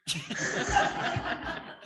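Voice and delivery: high breathy nervous laugh. Real, not acted. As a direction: laugh it off from nerves. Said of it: "I'm so nervous right now".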